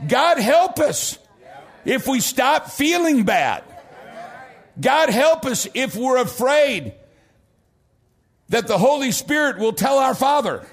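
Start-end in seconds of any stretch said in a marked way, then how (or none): 0.1-5.7 emphatic preached cry. Real, not acted. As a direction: cry it out to the congregation.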